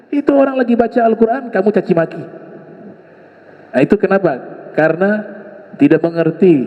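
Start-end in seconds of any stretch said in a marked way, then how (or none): 0.1-2.2 mock scolding wife. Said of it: "Suaminya marah".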